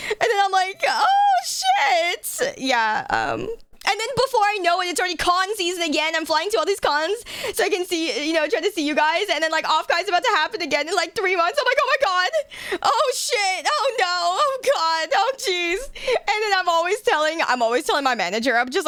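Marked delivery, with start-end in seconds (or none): 0.9-2.5 shocked realization. Retold or imitated. Realizing con season is here. 11.8-15.8 high, whimpering string of oh-gods; mock panic. Streamer acting her panic for chat, high mock whimpers.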